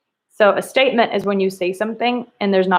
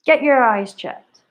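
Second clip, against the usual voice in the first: mild exclamation; 3 semitones higher than usual. Shown as a demonstration. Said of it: "What is it showing".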